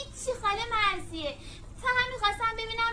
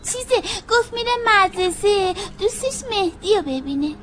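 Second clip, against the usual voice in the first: tearful; 5 dB louder than usual. Acted. Child covering for brother, crying.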